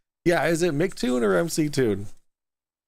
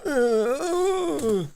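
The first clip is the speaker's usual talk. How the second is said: mock crying; 12 semitones higher than usual. Host fakes crying, mocking caller.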